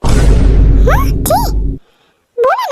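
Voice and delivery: high, loud startled yelp; sudden high shocked yelp; startled squeak. Acted. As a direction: squeak out the words, caught off guard. Asked to take his shirt off.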